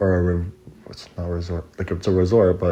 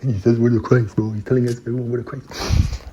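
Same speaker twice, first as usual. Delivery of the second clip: raised, excited imitation of gossips; mock alarm; 4 semitones higher, 4 dB louder than usual. Camp people spreading word to the boss, mock alarm. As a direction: pass it on as hammed-up alarm.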